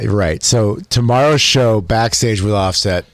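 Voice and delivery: ordinary delivery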